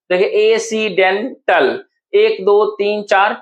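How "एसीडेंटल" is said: "एसीडेंटल" slowly, a gap before टल; four parts. Shown as a demonstration. Teacher counting four parts, slow and separated. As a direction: chop "एसीडेंटल" into ए, सी, डेन, टल. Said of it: "चार part में तो था".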